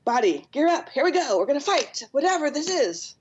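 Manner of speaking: rally the body like a nervous coach; a hint of an alarmed inner voice; brain gearing up. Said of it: "our brain is going".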